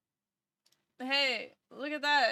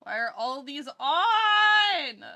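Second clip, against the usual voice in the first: slow, loud exasperated yell; frustrated shout; 3 semitones higher, 7 dB louder, slower than usual. Real, not acted. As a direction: shout it out, fed up with the noise. Frustrated at game noises.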